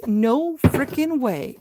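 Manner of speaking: slow, loud disbelief; stunned and thrilled; excited. Real, not acted. Excited at finding the prize.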